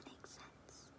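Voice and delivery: barely audible whisper; soft whispered reply; whispered agreement. Acted. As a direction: breathe it back conspiratorially. Whispered agreement, keeping secret.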